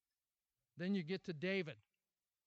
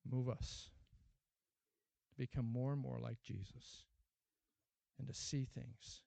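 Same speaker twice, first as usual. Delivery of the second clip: lower, prayerful closing; 4 semitones lower than usual. Acted. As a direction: speak to God humbly and low.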